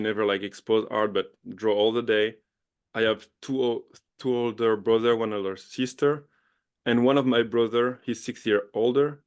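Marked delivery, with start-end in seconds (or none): none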